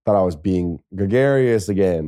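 plain reading voice

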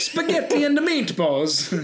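high, slow cartoon Italian accent; mock Italian accent. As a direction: stretch it out in a hammed-up Italian accent. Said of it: "Italian accent".

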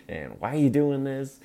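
bratty son, mock whine; real brat. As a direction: play a spoiled prince talking back. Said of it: "he's sounding like a real brat".

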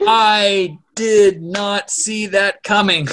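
slow, loud and high, word by word; stunned, with a laugh in it; emphatic disbelief. Real, not acted. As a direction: hammer out each word in amazement. Stunned reaction to the plot twist.

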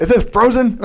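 high, put-on voice with laughter in it; mock appalled chef. Co-host imitating the chef inspecting a plate, mock appalled. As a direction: question the food in hammed-up disbelief.

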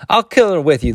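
high cried-out threat; indignant cry. A narrator's reading. Other woman joining the threat, indignant cry. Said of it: "the other one cried".

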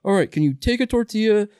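high-pitched mock customer request; put-on high customer voice; pleading drive-thru customer. Acts out drive-thru customer's special order.

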